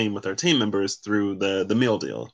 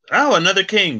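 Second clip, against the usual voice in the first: much higher, louder burst of real surprise; surprised exclaim; 11 semitones higher, 7 dB louder than usual. Real, not acted. Reader spotting another king in the spread, surprised. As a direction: jump at the sight of the card.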